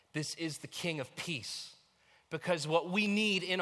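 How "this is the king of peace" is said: ordinary talk, nothing marked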